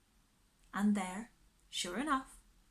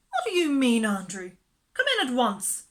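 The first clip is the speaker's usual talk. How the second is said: commanding fussy lady; 3 semitones higher, 8 dB louder than usual. A narrator's reading. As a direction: order the dog indoors. Miss Lark commanding.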